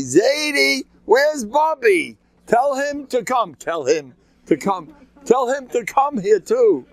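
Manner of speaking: put-on high, drawn-out little-kid shouts; kids yelling. Small kids yelling for their grandparents in the courtyard, high holler. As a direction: call out high and slow like small children. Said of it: "They're yelling at me".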